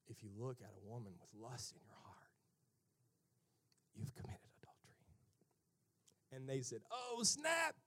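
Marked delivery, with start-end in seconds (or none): 0.1-5.0 low, near-whispered pronouncement; hushed gravity. Acted. Jesus warning Pharisees about lust, hushed. Jesus quietly confronts them. 6.9-7.7 sudden higher-pitched mock dismay; caught-out alarm. Pharisees caught by Jesus' lust teaching, alarmed.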